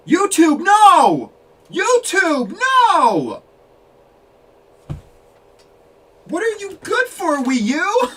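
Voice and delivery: real outraged yells at YouTube; furious yelling. Real, not acted. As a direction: scream at the crashing app in disbelief. Stream crashes mid reveal.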